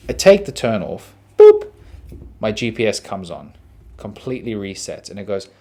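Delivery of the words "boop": high, loud electronic boop; imitated beep. Comedian mimics the GPS switching back on, bright beep. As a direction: chirp it like a gadget waking up.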